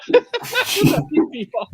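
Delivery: high laugh that swallows the words; real laugh breaking through the sentence. Real, not acted. Bursts out laughing mid-sentence.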